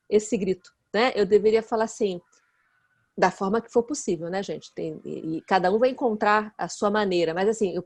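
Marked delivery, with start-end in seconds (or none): none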